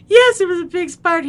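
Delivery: loud, high wailed reply; upset child's cry. Retold or imitated. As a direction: cry out the answer, imitating a distraught child. Crying child answers brother.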